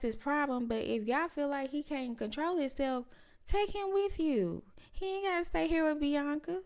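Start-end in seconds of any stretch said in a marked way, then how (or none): 3.5-6.6 exasperated. Real, not acted. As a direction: stretch it out in disbelief. Exasperated opinion on family.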